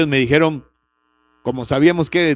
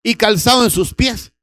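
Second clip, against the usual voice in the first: father's command; 6 semitones higher, 4 dB louder than usual. Acted.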